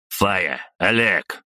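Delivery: understated sharp summons; mild bark at subordinates; barked command. A narrator's reading. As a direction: call the team to order. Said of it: "рявкнул я".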